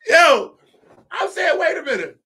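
high laughing yo; laughing protest. Real, not acted. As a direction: cut in, cracking up. Still laughing at the rival.

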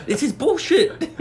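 high, loud protest through laughter; laughing mock outrage. Friend joining the angry-customer bit, in laughing mock outrage. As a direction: play indignant while cracking up.

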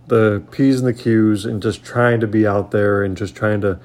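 normal talking voice

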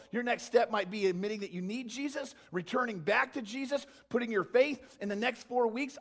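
ordinary delivery

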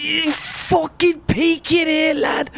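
high, slow, mocking tough-lad voice; hard-lad scally. Mocking a scally in an old photo, high drawn-out hard-lad voice. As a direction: sneer it out like a scally squaring up. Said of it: "He's like".

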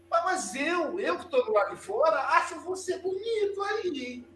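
high, soft sing-song grandma with a laugh; grandma cooing at the crying boy through the henhouse door; teasing grandma. Retold or imitated. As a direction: sweetly rub it in from outside the coop.

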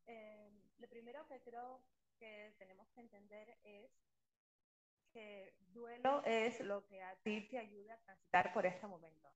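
faint voice dropping in and out; bad connection, fading in and out; audio cutting out. Real, not acted. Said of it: "Te va y te viene el audio".

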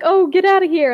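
loud, high shove-off yell; mock shout. Hunk jumps in and shouts Puppy off, loud and high. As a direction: bellow it to scare the beast away. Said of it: "Hunk's like".

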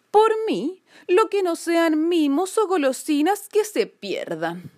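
high-pitched cat grumble; protesting cat. A narrator's reading. Stretching cat dismisses the lost moon, high-pitched protest. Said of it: "protestó el gato estirándose".

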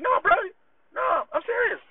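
high, emphatic no bro; squeaky high disbelief-buster; insistent retold reply. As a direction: push it up high, earnest insistence.

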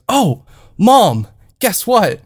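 high, bursting with news; acted-out excited announcement, pitch leaping up; excited son. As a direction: call out to mom with eager pride. Son's excited announcement in retold call.

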